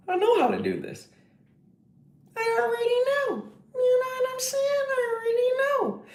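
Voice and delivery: high, soft squeaky boast; mock high voice. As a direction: squeak out a smug "I already know". Squeaky confident aside voice.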